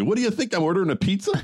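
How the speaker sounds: high-pitched, incredulous movie quote; mock exasperation. Caller to emergency dispatcher, mock exasperated. Acting out McClane's emergency-call line.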